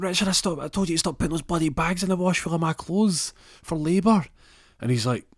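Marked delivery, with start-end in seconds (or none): 0.0-4.3 high-pitched scolding of a husband; raised, indignant nagging; acted exasperation. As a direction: tell him off in one exasperated rush. Wife scolds husband over washed bags.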